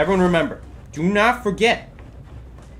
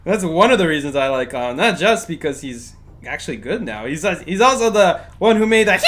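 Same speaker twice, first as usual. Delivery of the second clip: ordinary talk, nothing marked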